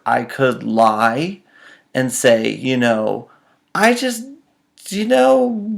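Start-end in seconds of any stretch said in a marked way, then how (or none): 3.7-5.7 high, squeaky fumbling excuse; nervous stammer. Retold or imitated. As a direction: squeak out a half-made lie. Fumbling lie to angry mom.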